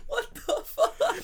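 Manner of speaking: high, drawn out through laughter; laughing disbelief. Real, not acted. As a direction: crack up in disbelief. Cracks up at the French story.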